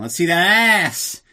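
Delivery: slow, loud, lecherous put-on voice; leering villainess. Kleb orders Tatiana to turn around, leering slow and loud. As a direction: drag it out like a leering boss.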